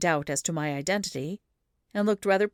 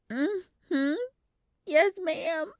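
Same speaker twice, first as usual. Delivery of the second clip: lightly voiced small boy with a hint of whimper; little whimper; 12 semitones higher, much slower than usual. A narrator's reading. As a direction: sniffle out a polite answer. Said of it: "with a little whimper".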